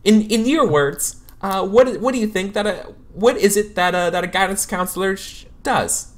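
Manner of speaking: bright and probing; chirpy counselor. Acted. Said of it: "she says".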